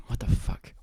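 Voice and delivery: whispered shock. Retold or imitated. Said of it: "And I'm like".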